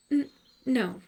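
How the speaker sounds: put-on hesitant small girl. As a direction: falter then refuse like a small child.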